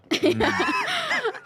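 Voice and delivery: loud, high squealing laugh; high burst of laughter. Real, not acted.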